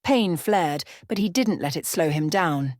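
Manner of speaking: usual speaking voice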